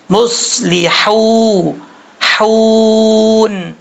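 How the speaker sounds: مصلحو then حون with very long held oo; slow tajweed elongation; lengthened madd. Shown as a demonstration. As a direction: stretch the oo of حون on one note. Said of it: "ایک الف کی مقدار لمبا کر کے پڑھیں گے".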